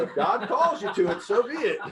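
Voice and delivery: laughing through a high, mock-solemn line; laughing reply. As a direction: grin and shrug it off, laughing. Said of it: "And I go".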